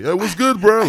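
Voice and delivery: loud, high, overdone hello; fake friendly greeting. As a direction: put on big phony enthusiasm.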